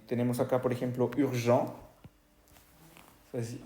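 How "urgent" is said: "urgent" as French ür-zhan, no T; silent final T. Shown as a demonstration. Teacher shows an unsounded last letter, silent T. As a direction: end "urgent" on the nasal vowel. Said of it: "no se escucha la T".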